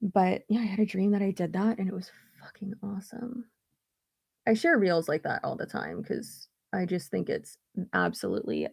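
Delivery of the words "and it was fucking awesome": hushed excited whisper; whispered awe. Real, not acted. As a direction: whisper it like a secret thrill. Unvoiced whisper.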